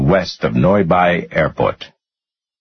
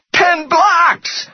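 very high, pained shriek. A narrator's reading. Rudder as weight goes on his finger, shrieking. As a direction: shriek the answer in agony. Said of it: "Rudder shrieked".

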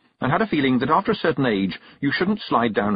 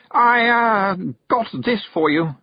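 high, loud, halting blurt; bursting with nervousness; 12 semitones higher, 5 dB louder, slower than usual. A narrator's reading. Young noble giving a gift, bursting with nervousness. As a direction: stammer out an awkward offering. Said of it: "looked as though he was going to explode with nervousness".

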